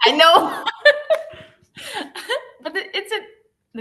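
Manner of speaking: high giggling break; laughing. Real, not acted. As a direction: crack up laughing. Reader cracks up mid-reading.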